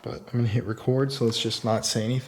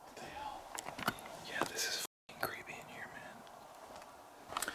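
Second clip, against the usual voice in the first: whispering; 17 dB quieter than usual. Real, not acted. Scared in the dark.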